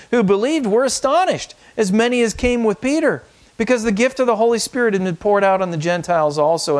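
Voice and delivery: high, ringing proclamation of the verse; scripture reading. Acted. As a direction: lift the verse high with wonder. Pastor reads Acts aloud.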